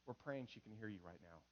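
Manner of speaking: very low, hushed reassurance; comforting pastor. Retold or imitated. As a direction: pastor softly consoling at a deathbed. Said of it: "have to sit there and go".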